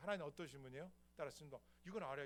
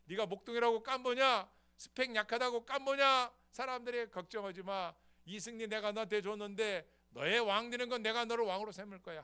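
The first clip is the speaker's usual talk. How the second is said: loud, higher encouraging call; God reassuring David; 7 semitones higher, 14 dB louder than usual. Acted. God assuring David he will be king, loud. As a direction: lift him up, don't worry. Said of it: "두 번째".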